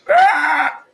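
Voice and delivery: banshee scream. Acted. Gamer showing off his banshee scream. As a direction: let out a shrill banshee wail. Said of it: "It's called a banshee".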